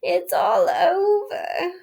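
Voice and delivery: high, slow, tearful whimper; crying in defeat, drawn out; miserable murmur. A narrator's reading. Toad in a heap on the road, sobbing to himself. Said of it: "murmuring to himself".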